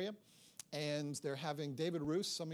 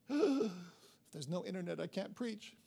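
mock panic; slower than usual. Real, not acted. Internet cuts out mid-sermon.

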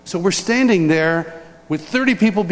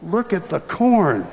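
mock casual; slower than usual. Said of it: "And I go".

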